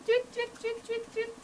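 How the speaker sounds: high chirpy tweet tweet like a little bird; playful birdsong imitation for the class. Shown as a demonstration. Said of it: "copy the beak of the bird like this".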